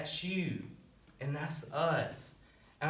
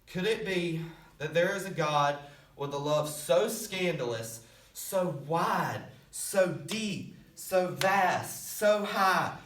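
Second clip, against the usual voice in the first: spaced phrases climbing in wonder; awed preaching, building; 3 semitones higher, slower than usual. Real, not acted. Preacher marvelling at God's scandalous love, building in awe.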